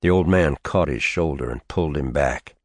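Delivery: usual speaking voice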